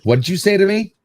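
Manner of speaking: indignant retort, re-enacted; retold anger. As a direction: throw the question back, offended, without shouting. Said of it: "I even got so angry".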